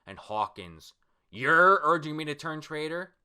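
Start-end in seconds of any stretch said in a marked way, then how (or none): 1.3-3.1 raised, higher, loud question; affronted manga character; incredulous. Acted. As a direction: act out scornful disbelief. Acted reply to suggestion of betrayal.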